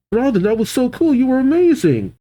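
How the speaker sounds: put-on high, quick excited girl voice; Nami's voice. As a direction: squeal it like an excited young girl. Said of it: "Nami says".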